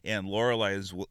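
normal talking voice

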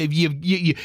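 ordinary talk

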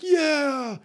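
high, loud, drawn-out cheer; rousing cheer. Acted. All the men watching the training montage, rousing cheer. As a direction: cheer like a fired-up crowd.

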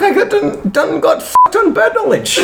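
high-pitched, laughing exclamation; helplessly amused and high; laughing disbelief. Real, not acted. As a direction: crack up while admitting defeat. Mock outrage at losing on birds.

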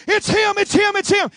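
high, urgent acted cry; hammed-up crowd excitement, repeated breathlessly fast; excited recognition. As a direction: exclaim it like an amazed onlooker. Voices the crowd recognizing him.

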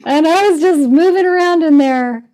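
high, loud, amused exclamation; amused retelling. Real, not acted. Telling how they moved around the dentist's, real amusement. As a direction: tell it loudly on the tail of laughter. Laughing at own dentist story.

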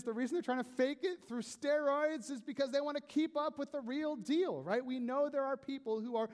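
full-force preaching. Acted. Pastor preaching to his congregation about steroids, full force. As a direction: preach it hard to the crowd.

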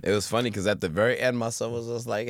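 ordinary talk, nothing marked

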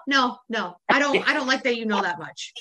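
normal talking voice

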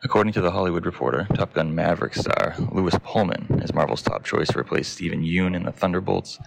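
low, even newsreader voice; news read. Acted. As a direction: read the headlines like a news anchor.

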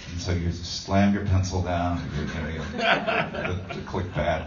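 normal talking voice

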